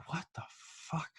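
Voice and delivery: retold whispered disbelief, drawn out slowly; stunned whisper. Man woken by his buzzer at night, stunned slow whisper. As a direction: whisper it slowly, as if startled out of sleep.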